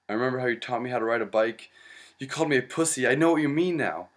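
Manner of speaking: hammed-up grieving son, fast and building; mock emotional outburst. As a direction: rush the joke out, building to a near shout. Son's joke memory to father.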